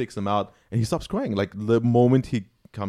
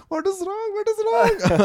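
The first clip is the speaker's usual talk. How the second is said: very high squeaky doctor voice with a laugh; squeaky high mock doctor; imitating the doctor. Doctor puzzled by crying father.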